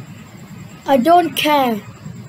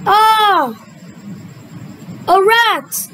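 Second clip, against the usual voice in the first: alarmed cry; 4 semitones higher, slower than usual. Pretend. Queen frightened by hamster.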